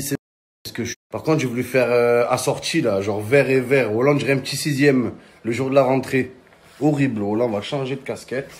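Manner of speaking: ordinary delivery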